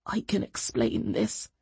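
low, slow, hushed plea; whispered. A narrator's reading. Accused doctor to his defender, whispering. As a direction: murmur it softly, guiltily. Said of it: "Niclés whispered".

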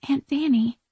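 usual speaking voice

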